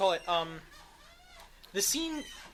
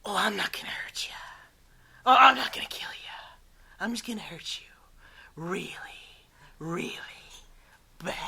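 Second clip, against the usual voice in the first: imitating Jared Leto's Joker; 2 semitones lower than usual. Host imitating Jared Leto's Joker, whispered mock menace. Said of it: "Jared Leto is very much doing his own things".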